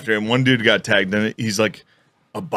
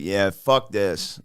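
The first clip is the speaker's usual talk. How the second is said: lightly imitated fighter, slow and low; soft, dismissive drag on the words; Nate Diaz; 4 semitones lower, 6 dB quieter, much slower than usual. Fighter giving up on interview, lightly imitated slow, low voice. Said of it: "I'll do the Nate Diaz".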